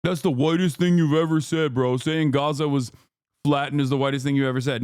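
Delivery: mock chatter voice. Streamer mimicking a chatter's whiny accusation, mocking voice. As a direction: sneer like the annoying chatter.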